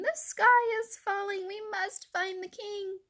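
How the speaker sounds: high, drawn-out mock shout. A narrator's reading. Birds charging down the path, mock shouting. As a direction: call the alarm high and slow.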